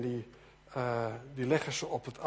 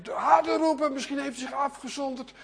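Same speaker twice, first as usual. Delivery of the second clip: high, raised jeer; mocking taunt; 8 semitones higher, 4 dB louder than usual. Elia taunts prophets about their absent god, high mocking call. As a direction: goad them in a high, pushed voice. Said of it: "zegt Elia".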